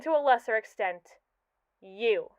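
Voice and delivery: ordinary delivery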